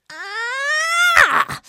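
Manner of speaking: hoher, lauter Wutschrei; wütendes Aufbrüllen. Acted. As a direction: schrei die ganze Wut in einem Laut heraus. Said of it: "brüllte der König jetzt". (wütender Aufschrei)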